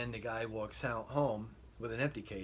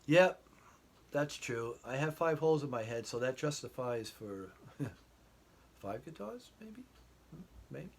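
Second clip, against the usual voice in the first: sheepish joke; 5 semitones higher, 6 dB louder than usual. Retold or imitated. Husband joking back to wife.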